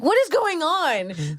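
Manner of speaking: high, drawn-out bewilderment; mock panic. Caught in the lie, high bewildered mock panic. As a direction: stretch it out in hammed-up alarm. Retells her own growing panic.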